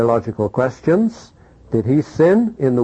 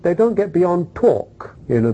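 ordinary delivery

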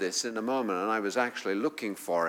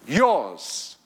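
"Yours" punched hard and louder, falling; emphatic yours; 3 semitones higher, 6 dB louder than usual. Acted. Preacher stressing to the congregation, "Yours" punched loud. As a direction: hit "Yours" like a promise. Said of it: "Now, it's emphatic".

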